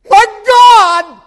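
high, sustained scream; agonized cry. Acted. Preacher crying Jesus' words, sustained scream. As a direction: howl it to heaven.

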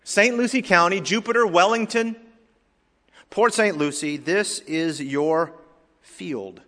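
high, loud, emphatic preaching. Real, not acted. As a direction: preach it out to the whole congregation.

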